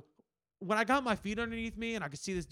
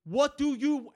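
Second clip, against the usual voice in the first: high, louder pressing question; impatient bartender; 8 semitones higher, 5 dB louder, much slower than usual. Retold or imitated. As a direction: imitate an exasperated bartender. Bartender pressing a drunk customer.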